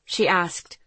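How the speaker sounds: ordinary delivery, nothing marked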